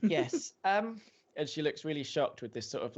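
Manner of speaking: ordinary talk, nothing marked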